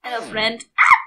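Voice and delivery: ordinary talk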